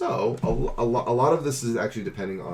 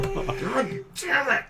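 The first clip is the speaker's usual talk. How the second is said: high, slow strained curse; drawn-out high-pitched frustration; exasperated outburst; much slower than usual. Acted. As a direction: squeeze the curse out slowly, way up high. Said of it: "You're just like".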